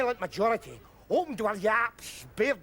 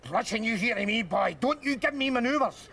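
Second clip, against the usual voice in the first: ordinary delivery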